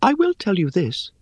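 high, airy, scornful little voice; airy spider voice. Acted. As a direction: chide them, light and dry.

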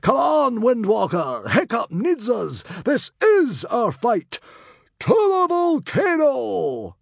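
high, full-voiced battle cry; rally them at the top of your voice; rousing heroic shout, last words drawn out. A narrator's reading. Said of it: "drawing his sword and shouting".

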